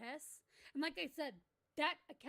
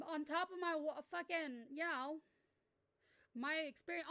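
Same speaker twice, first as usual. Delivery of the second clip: normal talking voice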